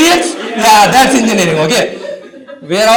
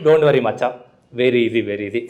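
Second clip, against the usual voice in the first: low, easy, dismissive reassurance; laid-back South Indian friend; 9 semitones lower, 6 dB quieter than usual. Retold or imitated. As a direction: drop low and shrug it off. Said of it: "whenever a South Indian says".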